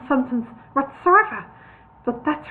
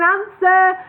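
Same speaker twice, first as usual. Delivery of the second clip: raised, louder demand; warning tone; 9 semitones higher, 8 dB louder than usual. A narrator's reading. Bingo demands an answer, warning. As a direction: insist with a warning edge. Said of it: "in a warning tone".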